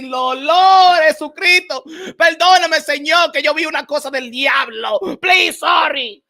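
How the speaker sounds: loud, high mock plea to God; mock prayer. Praying after seeing the tweet, loud hammed-up plea. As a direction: beg the Lord for forgiveness, hammed-up.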